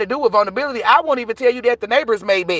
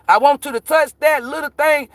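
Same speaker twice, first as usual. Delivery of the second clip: quoting [name]; 6 semitones higher than usual. Retold or imitated. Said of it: "Y'all hoes listen to [name]".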